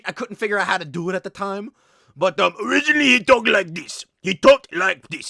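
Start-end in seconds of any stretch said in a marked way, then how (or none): 2.6-5.3 weird early monster voice with an odd accent; Cookie Monster. Shown as a demonstration. Creator showing his puppet's original voice, weird and accented. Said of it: "had a very weird voice".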